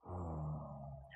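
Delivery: deep, soft breathy growl; low growl-like sigh. Retold or imitated. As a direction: groan into a voice module. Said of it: "he picks up the voice module thing and goes".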